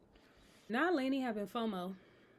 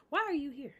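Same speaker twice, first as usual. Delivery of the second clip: louder, higher, demanding why she came; indignant outburst; 5 semitones higher, 6 dB louder than usual. Real, not acted. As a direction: call her out hard for showing up. Outraged at uninvited guest.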